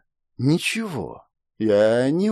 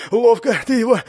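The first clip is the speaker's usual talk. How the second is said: panting, higher-pitched man; out of breath; 9 semitones higher, 3 dB louder than usual. A narrator's reading. Winded pursuer praising a companion's hit, out of breath. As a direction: say it while catching your breath. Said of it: "сказал запыхавшийся мужик".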